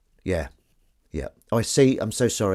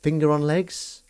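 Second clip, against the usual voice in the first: puzzled quote; 6 semitones higher, 3 dB quieter than usual. Retold or imitated. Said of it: "I go".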